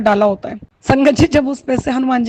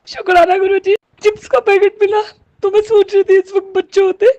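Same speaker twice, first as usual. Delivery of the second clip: high, strained voice welling with emotion; thankful but close to tears; choked up; 7 semitones higher than usual. Real, not acted. Mother thinking of her absent children, choked up.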